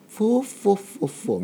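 high, fast ho-ho-ho chuckle; old man laugh. Shown as a demonstration. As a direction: cackle like an anime grandpa. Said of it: "フィクションの話し方".

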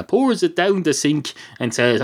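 plain reading voice

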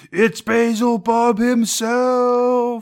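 high, long drawn-out mock scream; hammed-up villain's cry, stretched out high; acted scream. Two Torquemadas recognizing their foe at once, hammed-up scream. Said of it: "the two Torquemadas at once scream".

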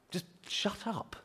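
very soft, slow, exasperated hush; hushed, fed-up plea; shushing. Acted. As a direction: shush him under the breath. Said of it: "quite a few people shushing him".

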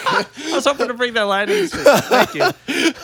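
real laughter through quick, high-pitched words; laughing. Real, not acted. Host thanking friends after his bit lands, laughing. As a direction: talk while cracking up at your own bit.

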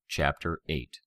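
plain reading voice

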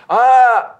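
high, loud mock scream; mock falling scream. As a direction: let out a long falling scream in jest. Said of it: "run out of scream".

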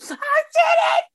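high, loud cry of joy; triumphant shout. Real, not acted. Telling the crowd she finished, a joyful shout. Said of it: "I'm like".

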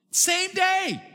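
high, loud amazed shout. Retold or imitated. Pastor telling the church about same-day delivery, amazed shout. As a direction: burst out in disbelief.

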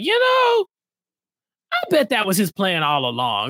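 hammed-up sudden suspicion; mock dawning realization. Host acting out players outside the jail figuring it out, mock pondering.

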